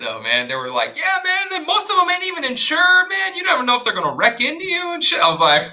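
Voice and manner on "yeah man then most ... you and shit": much higher-pitched mock neighbor rant; going in hard. Cookout neighbors complaining about immigrant drivers, mock indignant. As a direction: imitate a worked-up neighbor piling on complaints. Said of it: "they were going in hard".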